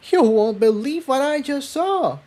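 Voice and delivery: very high, breathless excitement; excited cry. A narrator's reading. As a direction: burst out with the news, thrilled. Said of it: "she cried, obviously excited".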